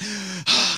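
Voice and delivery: loud exasperated huff; frustrated sigh. Retold or imitated. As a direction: blow out a frustrated breath. Retells struggle with luggage.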